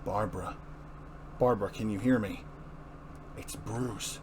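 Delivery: low, soft and calm; soft reassuring bedside voice; gentle concern. Acted. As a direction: speak softly to a hurt friend.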